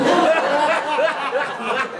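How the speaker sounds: loud high laugh; big burst of laughter. Real, not acted. Storyteller cracks up at the clerk's answer, laughing loudly.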